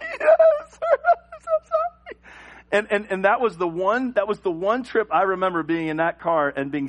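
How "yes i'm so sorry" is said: put-on very high, loud, slow child apology; high squeaky sorry through laughter; pleading kid. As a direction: beg forgiveness like a terrified boy.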